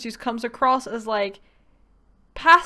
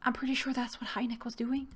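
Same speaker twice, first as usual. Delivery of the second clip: whisper; 8 dB quieter than usual. Real, not acted. Aside muttered low during play.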